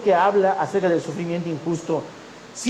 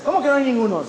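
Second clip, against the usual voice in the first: higher-pitched disbelieving retort; incredulous challenge; 6 semitones higher, faster than usual. Retold or imitated. Supervisor questions volunteer about missing collectors, incredulous challenge.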